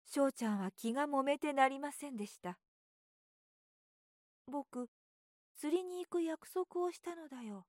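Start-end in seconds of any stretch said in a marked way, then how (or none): 4.5-7.7 put-on higher boyish voice, worried; a child regretting his promise; fretting little boy. A narrator's reading. Boy frets aloud about his fishing promise, higher and worried.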